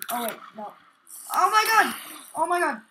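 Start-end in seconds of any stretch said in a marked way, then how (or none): 1.3-2.8 high, loud yelp of alarm, easing slightly; alarmed cry. Real, not acted. Player facing too many zombies, alarmed.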